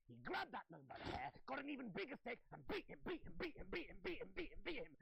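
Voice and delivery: high, loud, pounding repetition; wild escalating storyteller frenzy; frenzied narration. A narrator's reading. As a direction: tell it as if landing each blow, high and loud. Acting out a frenzied beating.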